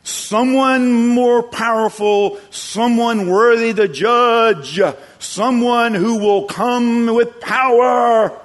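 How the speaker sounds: loud, high, drawn-out shout; ringing preacher's cry on a high, held pitch; prophetic proclamation. Acted. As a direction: proclaim it slowly at full voice, like a prophet. Preacher proclaims as John the Baptist.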